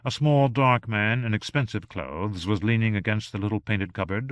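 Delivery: plain reading voice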